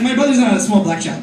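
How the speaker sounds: ordinary talk, nothing marked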